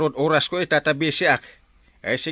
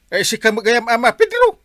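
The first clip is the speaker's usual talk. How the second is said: much higher and louder, rising onto the name; excited greeting; 9 semitones higher, 9 dB louder than usual. Acted. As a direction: hail the neighbour with big surprise. Greeting neighbour loudly by name.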